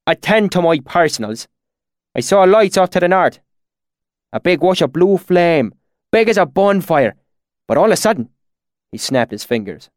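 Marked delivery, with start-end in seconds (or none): 0.1-8.3 high country-dialect storyteller; rustic swineherd. A narrator's reading. Swineherd recounting strange lights, in rustic dialect. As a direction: tell it like an earnest bumpkin.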